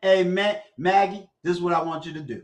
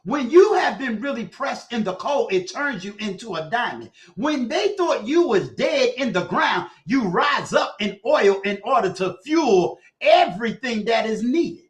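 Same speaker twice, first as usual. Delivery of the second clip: preaching build; 3 semitones higher, 4 dB louder than usual. Acted. Uncle preaching to Maggie about trials, rising shout. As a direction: drive the sermon to its peak.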